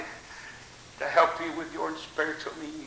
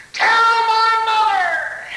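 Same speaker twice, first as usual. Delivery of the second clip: high, loud, slow tell my mother; slow loud plea; 12 dB louder, much slower than usual. Acted. Dying soldier begging the nurse, slow, high and loud. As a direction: stretch it out and lift it high.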